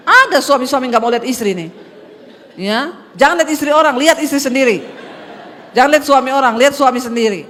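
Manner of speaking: loud commanding sermon voice; forceful preaching. Acted. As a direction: drive each line home to the congregation.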